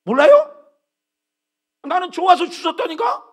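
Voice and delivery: imitating a defensive retort; defiant finder. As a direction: retort like a stubborn man. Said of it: "누가 지었냐".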